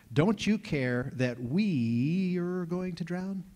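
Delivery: slow, pleading, acted desperation; desperate plea. Disciples wake Jesus in the storm, slow desperate plea. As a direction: drag it out like frightened fishermen. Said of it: "they start shouting".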